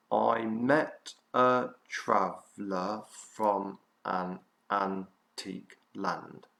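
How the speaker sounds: slow, evenly spaced syllables; measured syllable-counting chant, a gap after each beat. Shown as a demonstration. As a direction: count out each syllable slowly, one at a time. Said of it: "count with me all together".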